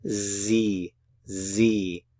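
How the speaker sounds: "sie" with a voiced z sound, like zee; German sie. Shown as a demonstration. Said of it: "of the S".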